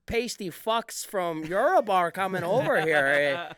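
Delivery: mock Maritime Canadian accent. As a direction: boast like a coastal Canadian. Xenophobic Canadian guy bit.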